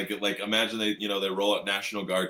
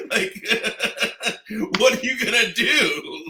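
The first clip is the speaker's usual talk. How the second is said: high, laughing disbelief; laughing at the absurd checkpoint; 11 semitones higher, 6 dB louder than usual. Real, not acted. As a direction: laugh through the words.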